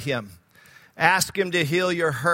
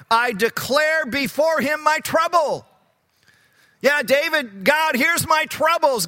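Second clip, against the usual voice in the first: high, raised preacher's voice crying out David's complaint; impassioned outcry; 6 semitones higher than usual. Acted. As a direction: cry it out to God with full force. Voicing David's complaint to God.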